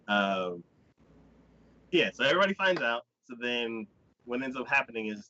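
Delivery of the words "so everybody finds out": plain reading voice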